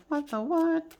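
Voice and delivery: high mock disbelief; mock amazement. Reader imitates tarot readers stunned by the deck, high mock disbelief. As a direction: squeak out a baffled double take.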